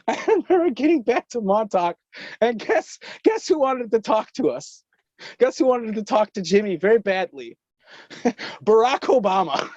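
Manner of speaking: can't keep from laughing as it comes out; laughing while telling. Real, not acted. Recounting the Montauk return, laughing. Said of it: "This shit's so funny".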